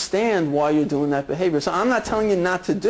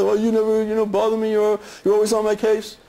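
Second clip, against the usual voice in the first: a hint of a kid yelling back, higher; half-hearted teen shouting at mom; mild mock yell; 3 semitones higher than usual. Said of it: "start yelling back at his mother".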